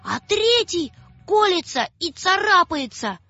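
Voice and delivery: put-on high, breathless scared girl; frightened child. Маша вбегает домой к папе, high frightened child. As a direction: rush it out in fear. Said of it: "кричит".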